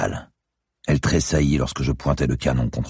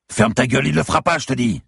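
high, strained angry yell; bellowing; 12 semitones higher, 4 dB louder than usual. A narrator's reading. Bleeding man to his girlfriend, bellowing. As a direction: bellow an order to shut up. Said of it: "beugla le gros tas".

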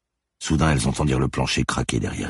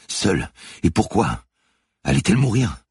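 rising, anguished inner questions; fearful, high-pitched urgency; panicked questions; 8 semitones higher than usual. A narrator's reading. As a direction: let the pitch climb with dread. Victim's panicked thoughts while pinned down.

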